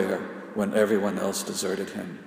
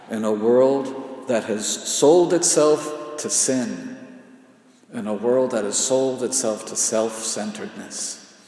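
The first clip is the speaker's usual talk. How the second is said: ordinary talk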